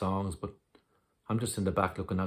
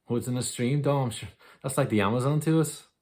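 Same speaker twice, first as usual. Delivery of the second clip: higher, louder, lifting with wonder; excited; 5 semitones higher, 5 dB louder than usual. Acted. Telling Dom about the stream's lure for Belfast boys, excited. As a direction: relish it like a great boyhood adventure. Boyish thrill at forbidden stream.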